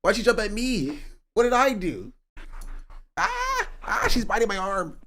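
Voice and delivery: high startled yelps at the dog; shocked cry as the dog bites. Real, not acted. Dog jumps and bites him.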